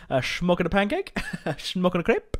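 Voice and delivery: light mock Dutch accent, quoting a film. Player quoting Austin Powers to viewers in mock Dutch accent. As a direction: do the Austin Powers Dutch bit, laughing through it. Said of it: "do any more accents".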